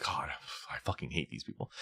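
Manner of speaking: hissed under the breath; seething whisper. Retold or imitated. Muttering at work people, seething whisper. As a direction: whisper the hate through your teeth.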